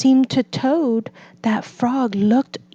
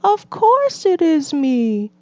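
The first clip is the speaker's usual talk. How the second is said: Frog reassuring; 8 semitones higher than usual. A narrator's reading. Frog answering.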